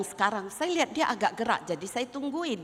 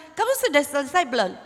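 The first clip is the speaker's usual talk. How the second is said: impatient mother; 6 semitones higher, 5 dB louder, faster than usual. Retold or imitated. Mother calls through door.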